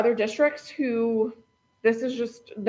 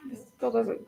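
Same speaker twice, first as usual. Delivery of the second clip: ordinary delivery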